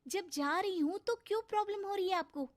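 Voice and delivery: high-pitched, upset protest; a wounded girl challenging her stepmother; hurt retort. Acted. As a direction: answer back, voice lifted well up. Stepdaughter answers back, hurt.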